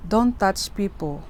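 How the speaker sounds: ordinary talk, nothing marked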